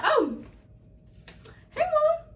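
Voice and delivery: bright greeting. Retold or imitated. Daughter answering mom's call, high chirpy greeting. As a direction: chirp a surprised hello. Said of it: "and I'm like".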